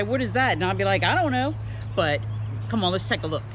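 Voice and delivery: ordinary talk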